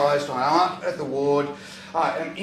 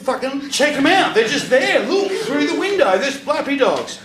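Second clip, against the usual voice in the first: much higher and louder, laughing insistence; high drugged excitement; 11 semitones higher, 7 dB louder than usual. Retold or imitated. To staff through the window, high excited insisting. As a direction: insist on it with high giddy glee.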